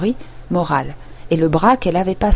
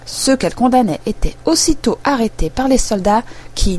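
plain reading voice

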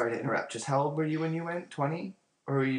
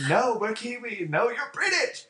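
high, insistent back-and-forth bickering; hammed-up indignant argument; mock quarrel; 11 semitones higher, 3 dB louder than usual. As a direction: contradict flatly in a high, playful squabble. Acted back-and-forth quarrel over accent.

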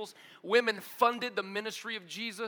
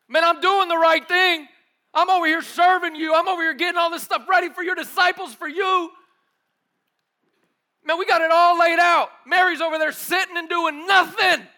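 much higher and louder whiny complaint; Martha complaining; 10 semitones higher, 13 dB louder than usual. Acted. Martha complains to Jesus about Mary, loud and high. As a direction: protest to Jesus, aggrieved.

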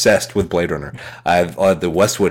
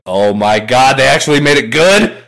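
rising to a loud, high thrill; giddy disbelief climbing in pitch; excited; 4 semitones higher, 4 dB louder than usual. Retold or imitated. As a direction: build from amazed to thrilled, getting louder. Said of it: "Now I'm even more excited".

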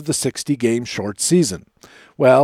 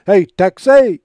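shouted call; 5 semitones higher, 7 dB louder, slower than usual. Acted. Radio bumper shout.

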